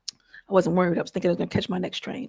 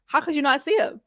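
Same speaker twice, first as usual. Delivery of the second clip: usual speaking voice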